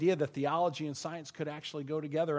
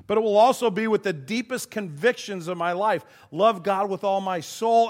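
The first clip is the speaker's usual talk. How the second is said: louder, insistent pulpit preaching; rising preaching fervor; 3 semitones higher, 5 dB louder than usual. Acted. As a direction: preach it out to the whole room. Preacher builds to full force.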